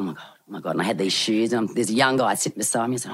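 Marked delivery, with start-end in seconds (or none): 0.0-0.7 hushed, much softer, lower panic; panicked muttering. Retold or imitated. Anxious traveller at the weigh-in, muttering to herself softly. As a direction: mutter it under your breath in a panic. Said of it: "And I'm sitting there going".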